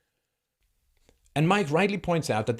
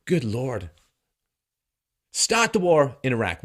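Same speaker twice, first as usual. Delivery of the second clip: ordinary talk, nothing marked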